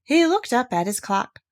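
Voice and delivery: plain reading voice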